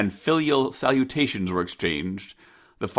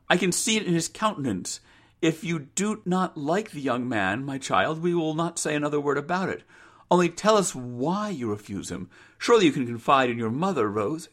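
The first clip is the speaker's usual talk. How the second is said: higher, gently persuasive mother's voice; coaxing mother; 5 semitones higher than usual. Acted. Mother asks Rose to confide, high and coaxing. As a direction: coax a confidence out of her.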